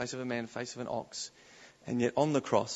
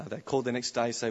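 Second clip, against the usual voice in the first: normal talking voice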